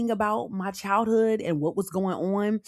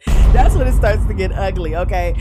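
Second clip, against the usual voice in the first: loud repeat through laughter; laughing loudly; 11 dB louder than usual. Real, not acted. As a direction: burst out laughing and say it much louder.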